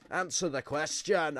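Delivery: imitating a heckling MP yelling at Boris; retold shouting. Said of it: "a mix of screaming and shouting".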